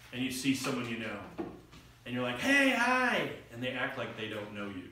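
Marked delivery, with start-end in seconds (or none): 2.4-3.3 high, loud friendly hello; eager greeting. Acted. Greeting an acquaintance at a function, eagerly. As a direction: call out to someone you know.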